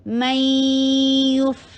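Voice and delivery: میں یف with the sound resting in the nose; slow nasal recitation of میں یف; held ghunna. Shown as a demonstration. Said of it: "آواز کو ناک میں ٹھہرائیے".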